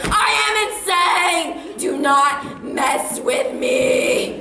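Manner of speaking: slow, loud, drawn-out crazed yell; hammed-up maniac war cry; insane scream. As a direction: bellow it while ramming the car. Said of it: "and I'm screaming".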